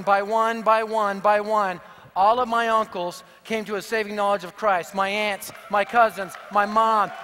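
usual speaking voice

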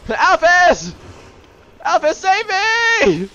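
high, loud drawn-out plea; cry for help. Pretend. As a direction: shout for rescue, stretching it out. Calling for help in game.

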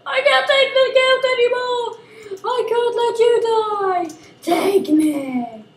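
high, hammed-up tragic cry; melodramatic hero. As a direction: ham up the noble sacrifice. Melodramatic sacrifice speech.

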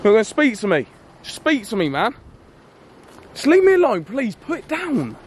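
begging him to stop, near breaking; desperate screaming. Acted. Vlogger begging the armed driver to put it down, desperate screaming.

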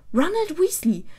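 high, loud angry shout of a full name; yelling. A narrator's reading. Hermine yells at sleeping Ron, high and loud. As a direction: bellow his full name to wake him. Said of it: "brüllte Hermine ihn an".

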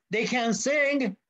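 put-on high, slow childlike protest; lightly voiced boy pleading; a touch of a small boy's whine.

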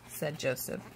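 ordinary delivery

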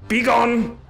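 high, dramatic dismissal; hammed-up high-pitched command; mock banishing cry. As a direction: send them away with theatrical force. Banishes someone in mock outrage.

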